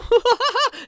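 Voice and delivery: high gloating cackle; evil villain cackle. Acted. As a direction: cackle like a cartoon mastermind. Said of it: "You hear over the intercom".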